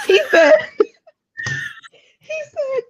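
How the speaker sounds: high, squeaky voice squeezed by laughter; overcome with laughter. Real, not acted. Retelling his reaction to her hair, choked with laughter. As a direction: try to get it out while cracking up.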